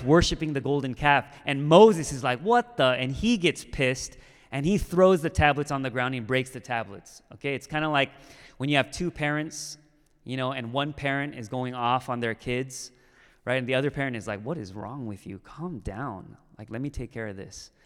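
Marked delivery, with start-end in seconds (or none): 2.4-2.8 high, loud, incredulous outburst; shocked outrage. Acted. Acting Moses's shock at the golden calf. 14.4-17.7 low, soft scolding parent voice; hushed reproach. Acted. Acting the calmer parent stepping in.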